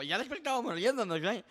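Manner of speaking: high, loud laughing relief. Real, not acted. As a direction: let the relief burst out. Relief after the scare. (alivio riendo fuerte)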